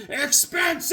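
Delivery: sudden high shrieking yell; snapping into a high scream. Retold or imitated. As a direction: lose it on the word Expensive. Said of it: "he's about to lose his mind".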